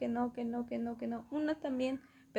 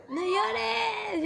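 mock soothing; 10 semitones higher, 4 dB louder than usual. Comforting crying boy, mock.

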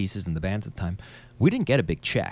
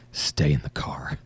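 low gravelly whisper; Batman voice; 6 semitones lower, slower than usual. Retold or imitated. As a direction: growl it like Batman. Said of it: "this Batman style voice".

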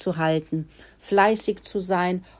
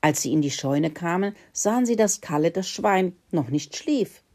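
plain reading voice

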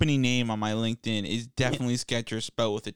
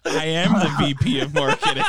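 louder, higher, declaring through laughter; hammed-up self-important executive; laughing proud boast; 5 semitones higher, 6 dB louder than usual. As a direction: announce your title grandly while cracking up.

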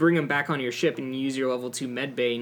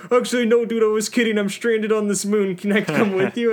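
higher, louder, pleading change of heart; pleading stranded alien; 7 semitones higher, 7 dB louder than usual. Retold or imitated. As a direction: beg to come along, pitched up and loud. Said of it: "he's like".

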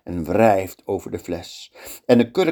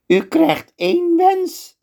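much higher, slow and otherworldly; high slow wizard bargaining; 3 dB louder, slower than usual. Acted. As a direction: play the scheming wizard, slow and sly. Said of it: "zegt de tovenaar".

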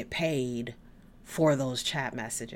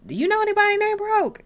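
high, loud, fast sassy squeal; mocking broke callers in a high sassy squeal; 10 dB louder, faster than usual. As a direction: shriek it like the name is absurd. Sassy mock question about Broke.

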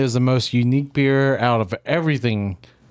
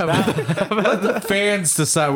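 slow, higher, drawn-out mock echo; mock announcer echo; 6 semitones higher, much slower than usual. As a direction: stretch it out like a slogan. Mocking echo of friend.

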